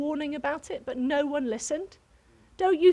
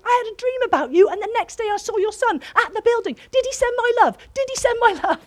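much higher and louder, rushed and breathless; retold excited greeting, pitched way up; frantic excitement; 11 semitones higher, 12 dB louder than usual. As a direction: blurt it all out at them like a mad woman. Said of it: "I jumped on them like a mad woman".

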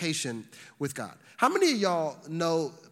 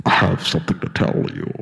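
Sean Connery; 11 semitones lower, 11 dB louder than usual. Acted. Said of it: "come down in Sean Connery's voice".

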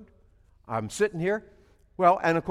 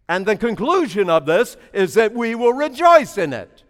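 usual speaking voice

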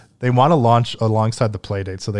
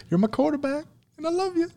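much higher, gushing falsetto; mock sweet falsetto. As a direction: coo it in a silly high voice. Gushing to his co-hosts.

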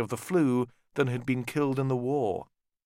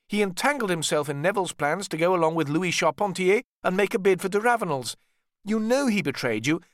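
high, louder accusing brother voice; aggrieved brother; 9 semitones higher, 3 dB louder than usual. Acted. Richard tells Ned that George betrayed him, high accusing. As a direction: press the charge of betrayal.

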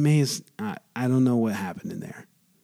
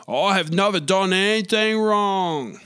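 Cory Booker impression; 5 semitones higher than usual. Shown as a demonstration. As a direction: say it like a politician defending himself, slightly raised. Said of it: "he didn't talk like that".

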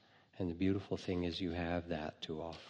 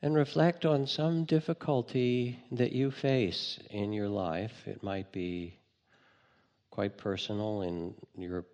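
guided meditation. Acted. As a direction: calmly lead listeners inward.

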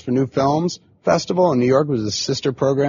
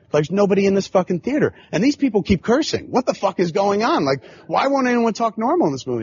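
higher-pitched panicked inner voice; retold panic; 7 semitones higher than usual. Young filmmaker panicking at his empty screening, retold panic. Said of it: "it was hyperventilation".

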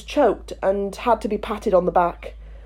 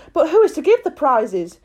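crowd voice; 6 semitones higher, 3 dB louder than usual. Acted. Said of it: "a chorus of voices asked".

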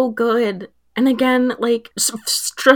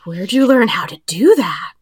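hushed, amazed whisper; awed whisper. Retold or imitated. Wells marvels at a straight line, whispering in awe. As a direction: breathe it out in wonder. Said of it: "Wells fully goes".

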